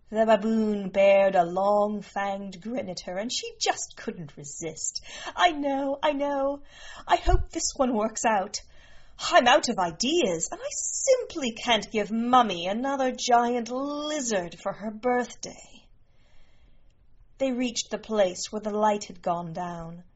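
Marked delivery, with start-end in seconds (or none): 5.3-15.9 higher-pitched, exasperated princess voice; out of ideas and fretting aloud; fretting princess daughter. Acted. Princess frets over Mummy's birthday gift, higher and exasperated.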